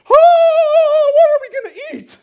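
mock-panic in a cartoonish squeal; panicked disciples. Disciples fretting over one loaf, shrill falsetto panic. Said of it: "and they're like".

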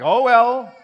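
exaggerated sardonic "oh well"; sarcastic. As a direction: toss it out as if dying were no big deal. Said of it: "if he's being sarcastic".

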